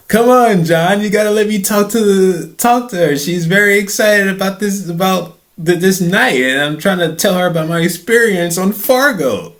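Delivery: high, loud whiny plea; pleading. Retold or imitated. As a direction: beg him to back off. Begging Jon to let him talk.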